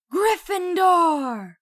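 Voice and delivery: huge, drawn-out high shout; slow, booming announcement to the hall; hat shout. A narrator's reading. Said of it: "heard the hat shout".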